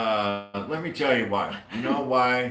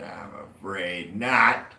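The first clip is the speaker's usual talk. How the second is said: slow punchline; 2 semitones lower, 5 dB louder, much slower than usual. Acted.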